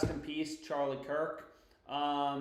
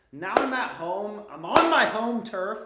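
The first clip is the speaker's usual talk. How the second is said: higher and louder, proud boast; proud home-turf boast; excited; 5 semitones higher, 8 dB louder than usual. Real, not acted. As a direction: crow about being back on home turf.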